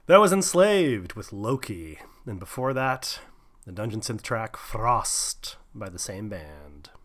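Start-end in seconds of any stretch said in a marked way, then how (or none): 3.6-6.9 soft, slow whisper. Acted. Host whispering the dungeon synth track name to listeners. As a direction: breathe the track name like a secret.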